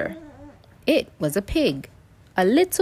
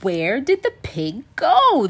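higher-pitched, puzzled outcry; bewildered cry; 6 semitones higher than usual. A narrator's reading. Animals find the pig gone, crying out. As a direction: cry out the question, rising on the key words. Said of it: "the animals cried".

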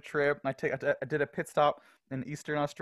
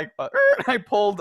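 normal talking voice

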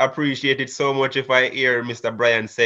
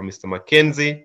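normal talking voice

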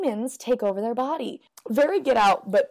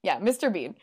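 ordinary talk

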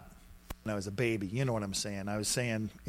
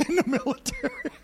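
high squealing laugh into the words; faster than usual. Real, not acted. Preacher laughing at his old temper, high laugh.

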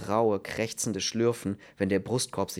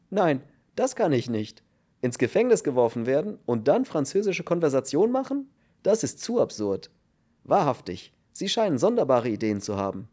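scoffing, higher voice of a baffled prisoner; incredulous cellmate; 4 semitones higher, 4 dB louder than usual. A narrator's reading. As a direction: exclaim at an absurd suggestion. (empörter, ungläubiger Häftling)

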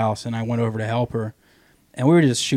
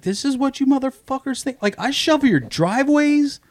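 indignant; 11 semitones higher than usual. Retold or imitated. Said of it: "I'm like".

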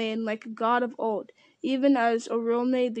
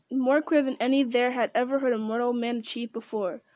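plain reading voice

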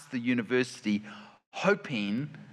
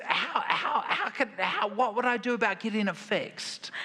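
high, laughing imitation of his daughter; 7 semitones higher than usual. Father retelling his daughter's call, laughing high. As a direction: giggle through the daughter's helpless question. Dad voicing daughter asking for help.

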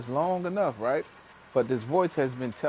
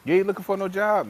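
mock incredulous friend; 8 semitones higher, 6 dB louder than usual. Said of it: "Gerard, like, bro".

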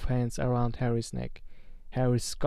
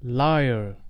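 a sudden, mildly loud shout; 4 dB louder than usual. Acted. Harry defying Voldemort with a mildly loud shout. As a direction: fling the word out. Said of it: "Harry shouted suddenly".

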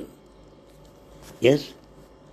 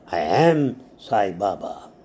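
determined; 7 semitones lower than usual. A narrator's reading. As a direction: state it calmly and with full certainty, slowly. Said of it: "In a determined voice".

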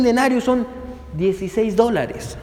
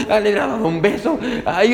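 normal talking voice

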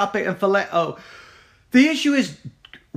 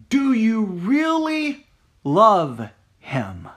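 slow, searching; much slower than usual. Acted. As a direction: stretch the question out slowly, weighing really. Same question asked twice differently.

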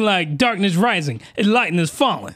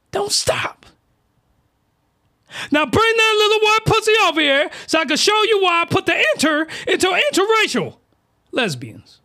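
high-pitched, emphatic character demand; hammed-up eager character, high and insistent; Beast Mode voice; 13 semitones higher than usual. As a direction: order them over, pitched way up. Acting Beast Mode's lusty demand.

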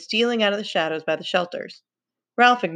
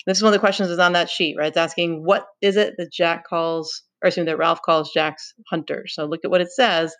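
plain reading voice

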